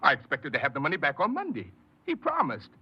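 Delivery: cling to the excuse, almost breaking; cornered son swearing his father will explain; desperate pleading. Acted. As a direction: voice leaping high and loud in panic.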